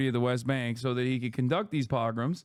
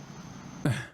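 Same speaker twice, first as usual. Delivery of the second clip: weary sigh; 6 dB quieter than usual. Real, not acted. Tired of the topic.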